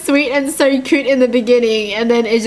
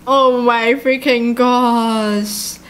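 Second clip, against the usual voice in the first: very slow, stretched-out disbelief; slow, overwhelmed exclamation; stunned; much slower than usual. Real, not acted. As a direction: drag out each word in shock. Stunned by the story's revelation.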